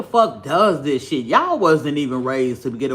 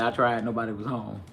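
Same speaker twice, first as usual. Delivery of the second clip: quieter mock quote; 6 semitones lower, 8 dB quieter than usual. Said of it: "She sure said".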